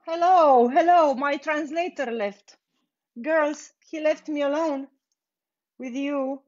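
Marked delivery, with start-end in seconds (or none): none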